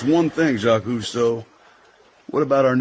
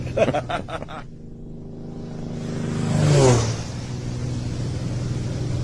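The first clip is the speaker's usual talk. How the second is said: laughing; 3 semitones higher, 3 dB quieter than usual. Acted. Laughing after girl covers up.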